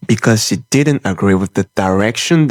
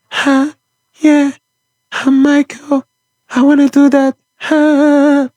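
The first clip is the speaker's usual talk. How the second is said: much higher, breathy imitation of a famous pop star's press voice; 4 dB louder than usual. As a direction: do the soft, breathy, high-pitched press-conference voice. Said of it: "speaks in that soft, breathy, high-pitched voice".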